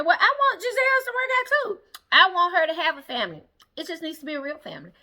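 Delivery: high, prim imitation of Karen; mock Karen voice. Recapping Karen's reunion answer to Andy, high mock voice.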